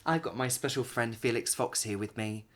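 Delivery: ordinary delivery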